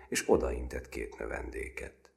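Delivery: ordinary delivery, nothing marked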